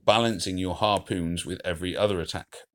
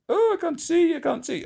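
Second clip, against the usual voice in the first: very high, panicked cry of alarm; alarmed squeal; 4 dB louder than usual. Real, not acted. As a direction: squeak it out, unable to see. Screen blocked during the attack.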